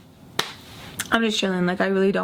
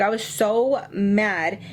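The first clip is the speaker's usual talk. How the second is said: ordinary talk, nothing marked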